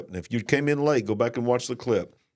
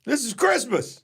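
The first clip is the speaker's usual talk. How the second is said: festive cheer; 13 semitones higher than usual. Real, not acted. Festive exclamation.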